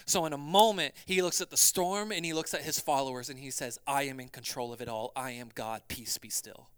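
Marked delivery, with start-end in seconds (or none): 3.9-6.7 calm authority. Acted. Jesus speaks to storm.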